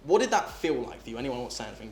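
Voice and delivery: ordinary talk, nothing marked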